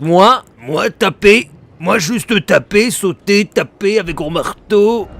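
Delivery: a hint of a simple caveman; dopey cartoon bellow. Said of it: "Si vous ne connaissez pas la ref".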